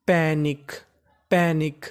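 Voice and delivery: "पैनिक" said twice, low and deliberate; teacher reading "पैनिक"; model pronunciation. Shown as a demonstration. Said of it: "P A N आई सी के".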